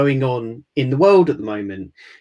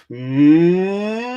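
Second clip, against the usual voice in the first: lips pursed, hum a rising siren. Shown as a demonstration. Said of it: "Here goes the second".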